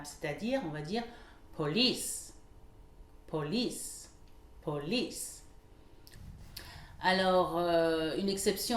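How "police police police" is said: LICE stressed in "police", PO light; second-syllable stress. Shown as a demonstration. Teacher demonstrates a French-origin word, stress on LICE in "police". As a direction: lean on the end: po-LICE. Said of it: "l'accentuation se fera sur la deuxième syllabe".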